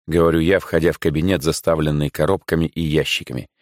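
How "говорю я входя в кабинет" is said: ordinary delivery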